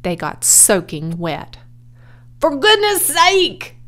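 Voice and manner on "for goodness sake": high, loud, drawn-out exasperation; bellowing. A narrator's reading. Drenched owner scolds his helper, exasperated bellow. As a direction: bellow it in outrage. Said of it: "bellowed Mister Zuckerman".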